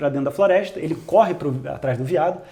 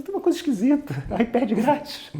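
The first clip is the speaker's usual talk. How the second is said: laughing doubt; 5 semitones higher, 4 dB quieter than usual. Retold or imitated. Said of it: "Aí você fala".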